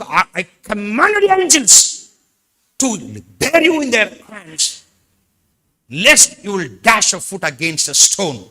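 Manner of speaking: loud, very slow, stretched-out proclamation; declaimed scripture. Acted. Pastor proclaims the angels' charge, loud drawn-out declaiming. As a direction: intone every word of the verse. Preacher declaiming psalm verse.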